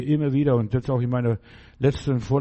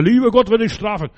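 retold shout; 8 semitones higher, 8 dB louder than usual. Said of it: "Dann sagt er".

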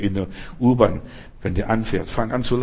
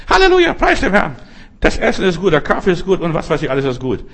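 gushing pious sister; 5 semitones higher, faster than usual. Retold or imitated. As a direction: gush about everything like the sister. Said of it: "Die war Pfingstlerin". (hohe, schwärmerische Stimme, die abklingt)

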